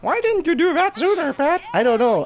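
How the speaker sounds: high mock voice. Mock dialogue with teammate.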